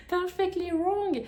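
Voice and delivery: high, slow, exaggerated English accent, laughing into it; hammed-up English accent. Shown as a demonstration. Mocking her bad English accent on the book title, hammed-up English accent. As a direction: overdo a posh English accent, stretching Wrong. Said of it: "oh, l'accent anglais nul".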